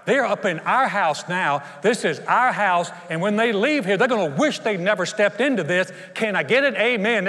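hammed-up vocal team captain; team pep talk. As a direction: fire up the team before the game. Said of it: "they usually get the most vocal person on the team".

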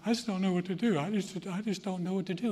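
a hint of a troubled child; mock helpless kid. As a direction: whine it like a lost kid. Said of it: "you want your kids walking in going".

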